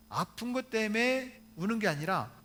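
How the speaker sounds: ordinary delivery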